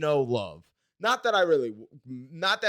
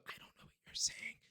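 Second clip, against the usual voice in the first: whispered, helpless aside; whispered plea; 12 dB quieter than usual. Retold or imitated. Learner lost in Japanese phrases, helpless whisper. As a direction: whisper it as a desperate inner plea. Silent plea shown by his look.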